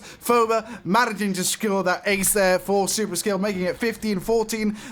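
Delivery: high, lively play-by-play call; excited call. Real, not acted. Caster hyping the round-winning ace, excited. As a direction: ride the hype of the ace.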